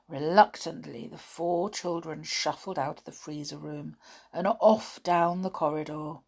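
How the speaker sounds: usual speaking voice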